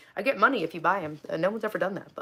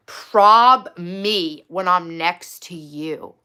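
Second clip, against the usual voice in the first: loud, very slow quote; drawn-out, incredulous quoting; slow, weighted read-out; 8 dB louder, much slower than usual. Retold or imitated. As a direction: land each word separately and loudly. Reads match's reply with slow emphasis.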